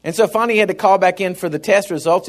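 usual speaking voice

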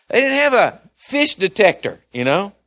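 high, loud, impassioned sermon build; climbing into a fervent preaching roar; full-force preaching. Acted. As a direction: preach it out, rising to a cry.

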